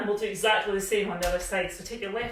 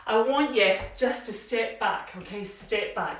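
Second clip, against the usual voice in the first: ordinary talk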